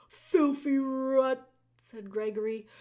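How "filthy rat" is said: loud, stammering gruff jailer; bellow, tripping over the words; booming jailer voice. A narrator's reading.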